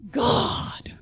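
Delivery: breathy whispered plea; a hushed, desperate God; distressed whisper. Retold or imitated. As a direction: whisper it in despair. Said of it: "That thing we always say when we are most distressed".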